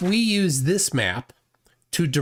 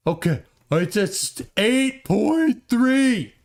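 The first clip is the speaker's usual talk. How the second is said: higher and very slow, every word drawn out; dim helper Ray; 7 semitones higher, much slower than usual. Retold or imitated. Clueless Ray slowly reading the number back to his boss. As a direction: play the slow helper who has no idea.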